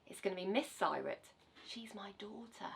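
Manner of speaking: usual speaking voice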